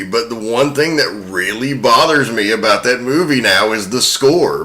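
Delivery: ordinary talk, nothing marked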